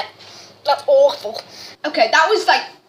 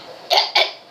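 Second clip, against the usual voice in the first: coughing. Real, not acted. Gagging after pickle water.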